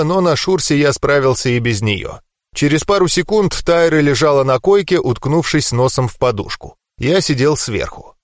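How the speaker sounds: ordinary delivery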